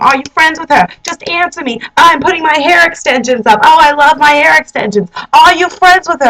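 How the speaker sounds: high, insistent mock whine; imitated nagging girlfriend. Girlfriend asking boyfriend over and over, imitated nagging. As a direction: pester him in a put-on high voice. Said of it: "asks him and asks him".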